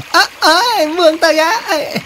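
high-pitched panicked plea to be freed; alarmed protest. Acted. Spider to the crab that has grabbed it, alarmed. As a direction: squeal it like a creature suddenly grabbed.

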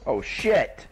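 loud sudden curse; startled curse. Real, not acted. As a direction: blurt it out in shock. Sudden attack in game.

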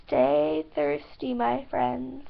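mock announcer sign-off. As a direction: drop into a slow commercial-style sign-off. Signature sign-off catchphrase.

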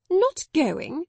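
high, loud disbelieving echo; incredulous. A narrator's reading. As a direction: she can't believe it. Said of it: "losing her senses".

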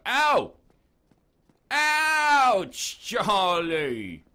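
loud, high mock yelps of pain; Michael Caine yelping. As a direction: yelp as a spanked Michael Caine. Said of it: "Michael Caine being spanked".

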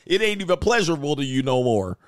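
usual speaking voice

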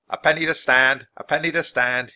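repeated barker's cry; shouted announcement. A narrator's reading. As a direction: call out the price to a crowd. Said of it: "he shouted".

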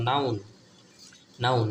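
usual speaking voice

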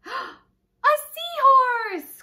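very high, loud, slow drawn-out cry; joyful cry. Acted. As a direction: stretch it out in delight. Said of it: "cries Pete".

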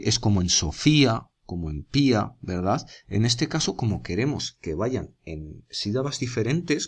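ordinary talk, nothing marked

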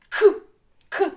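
loud, popped К, twice; isolated consonant demo. Shown as a demonstration. Teacher shows the last sound, К popped separately. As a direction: fire out a lone К burst. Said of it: "І звук".

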